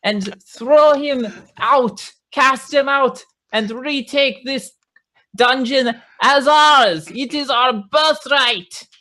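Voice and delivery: high, rousing goblin orator; rally the troops in a put-on goblin voice; hobgoblin disguise voice. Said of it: "with my disguise voice".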